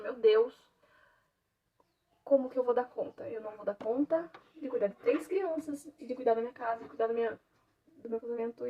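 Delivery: normal talking voice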